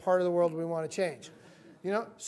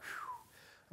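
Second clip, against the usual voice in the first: soft, voiceless exhale of relief; imitated sigh of relief; relieved whew; 17 dB quieter than usual. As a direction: blow out a quiet "whew". Retells relief at being accepted.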